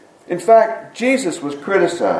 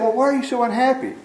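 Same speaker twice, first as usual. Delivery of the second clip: lightly voiced baffled friend, higher and quicker; puzzled friend; 8 semitones higher, faster than usual. Friend puzzled by the man's gloom, mildly baffled. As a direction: ask it like a friend who can't make sense of it. Confused friend questions the man.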